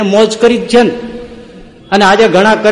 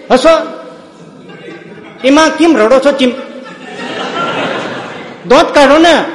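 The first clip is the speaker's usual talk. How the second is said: confident uncle; 5 semitones higher than usual. Retold or imitated. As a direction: laugh off their fear as the fearless uncle. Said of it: "મારે એને બે ત્રણ વાર આવી રીતે કીધું".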